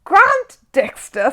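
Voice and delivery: high, loud, slow outraged splutter. A narrator's reading. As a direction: choke on the name in outrage. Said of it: "spluttered Sir Archibald".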